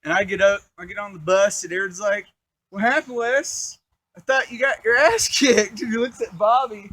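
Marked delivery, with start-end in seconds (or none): 2.7-5.8 loud, high mock-innocent question; imitating a friend's loud ribbing; amused teasing. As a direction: call it out tauntingly with a chuckle. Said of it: "Aaron's just, like, laughing".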